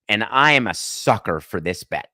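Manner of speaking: normal talking voice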